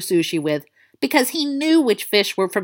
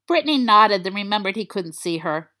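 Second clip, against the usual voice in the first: usual speaking voice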